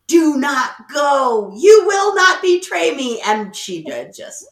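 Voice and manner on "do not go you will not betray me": slow, loud, higher mock order to her own tears; hammed-up stern order, dragged out; mock stern command. Niece ordering her tears to stay put, mock stern and loud. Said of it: "you could see her just like".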